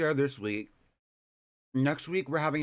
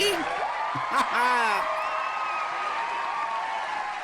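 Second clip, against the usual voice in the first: loud squealing laugh, much higher; high shrieking laugh; 3 dB louder than usual. Real, not acted. Laughs at own joke.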